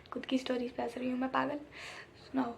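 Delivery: a fast self-mocking whisper; teasing herself in a quick whisper; whispered aside. Real, not acted. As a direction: whisper it to yourself, amused.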